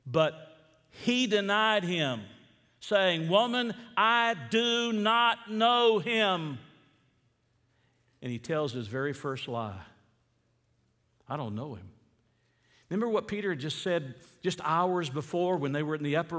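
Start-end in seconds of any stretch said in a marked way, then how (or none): 3.3-6.6 loud, high, drawn-out denial; emphatic denial. Acted. Peter's denial acted from scripture. 11.3-11.9 low, soft retold denial; understated denial. Preacher repeats Peter's first lie.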